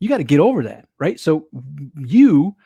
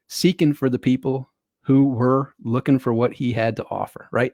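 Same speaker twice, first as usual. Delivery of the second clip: ordinary talk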